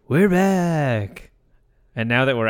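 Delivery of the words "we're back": drawn-out, higher-pitched announcer greeting; mock show-opener call. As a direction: hail the listeners in a long singsong. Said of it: "we say, we would say".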